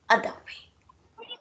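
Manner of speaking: slow, teasing whisper; coy whisper. Acted. Nora teases Christine with a coy whisper. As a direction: drop to a sly hush. Nora hints coyly at secret.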